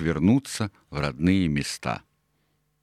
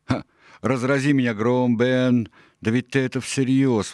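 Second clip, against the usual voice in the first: higher-pitched, amazed outburst; astonished exclamation; 6 semitones higher, 4 dB louder than usual. A narrator's reading. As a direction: burst out in disbelief. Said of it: "воскликнул он". (изумлённое восклицание, высоким голосом)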